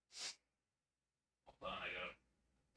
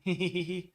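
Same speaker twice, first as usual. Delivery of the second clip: short laugh; 6 semitones higher, 7 dB louder than usual. Real, not acted. Laughs at own joke.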